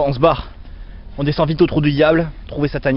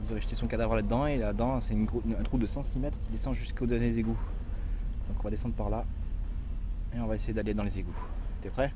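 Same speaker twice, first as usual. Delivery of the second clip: hushed narration; 3 semitones lower, 12 dB quieter than usual. Acted. Hushed narration entering the cave.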